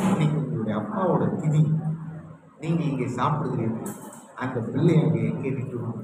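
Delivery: low, softened retold grief; subdued sorrow. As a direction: voice the tearful sister-in-law, understated and low. Tearful sister-in-law answers her husband. (அடங்கிய சோகக் குரலில்)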